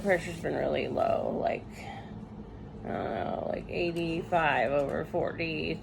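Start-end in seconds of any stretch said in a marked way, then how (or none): none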